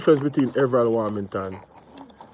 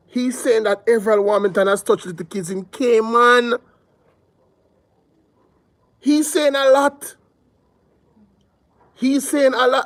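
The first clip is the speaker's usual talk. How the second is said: A LOT held up at a high pitch; real emphasis; 8 semitones higher than usual. Real, not acted. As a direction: hammer CAYMAN and A LOT high, with pauses. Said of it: "I have to emphasize the point".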